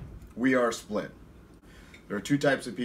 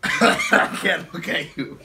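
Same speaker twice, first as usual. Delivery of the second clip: slow, high, helpless laughter; giggling too hard to speak; laughing; 5 semitones higher, 6 dB louder, much slower than usual. Real, not acted. Host cracking up at sick guest.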